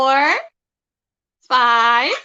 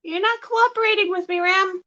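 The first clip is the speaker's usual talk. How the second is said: high pet-talk voice; 7 semitones higher, faster than usual. Real, not acted. Teasing uncooperative parrot.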